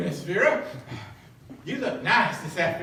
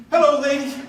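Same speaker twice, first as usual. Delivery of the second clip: out of breath; 3 semitones higher, 4 dB louder, faster than usual. Acted. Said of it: "you're huffing like an old carburetor".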